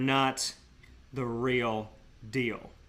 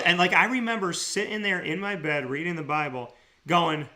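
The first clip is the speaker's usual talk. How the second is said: ordinary delivery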